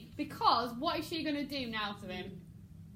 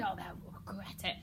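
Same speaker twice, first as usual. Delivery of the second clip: menacing; 5 semitones lower, 7 dB quieter than usual. Shown as a demonstration. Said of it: "Again, with regret it".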